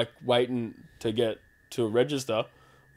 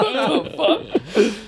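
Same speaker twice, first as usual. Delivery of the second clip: higher, slow laughing "what the fuck"; laughing disbelief; 9 semitones higher, much slower than usual. Retold or imitated. Seeing himself in the dress, laughing shock. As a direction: stretch it out through a laugh.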